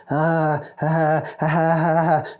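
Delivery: awkward fake laughter; forced laugh. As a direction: laugh emptily through a fixed grin. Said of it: "total kind of shit-eating grin".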